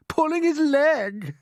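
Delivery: high, loud and slow gleeful shout; gleeful cry. Acted. Centipede mocking the ladybird, gleeful cry. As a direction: crow it with delight. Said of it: "he cried, wriggling with glee".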